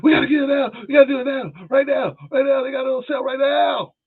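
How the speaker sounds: imitating wife shouting. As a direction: shout like the wife demanding it right now. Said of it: "my wife was like".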